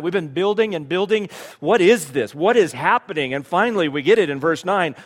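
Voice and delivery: fired-up preacher; preaching at full force. Acted. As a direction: drive it home to the congregation.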